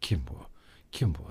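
low, weak wheezy question; wheezing, choking. A narrator's reading. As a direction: struggle to get the words out, softly. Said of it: "hırıltılı bir sesle ve tıkanırcasına". (hırıltılı, tıkanırcasına)

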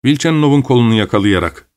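plain reading voice

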